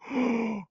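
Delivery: quick high gasp of shock; sharp shocked gasp. Real, not acted. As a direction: gasp in surprise. Reacting to the hit.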